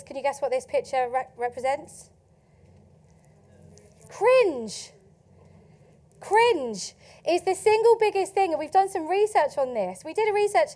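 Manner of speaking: ordinary talk, nothing marked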